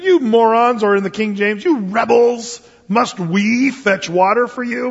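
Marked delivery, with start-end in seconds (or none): 0.0-0.8 higher, louder mock outburst; angry yell. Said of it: "He said". 1.6-4.9 higher, louder and slow exasperated scolding; angry rebuke. Retold or imitated. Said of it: "Moses says".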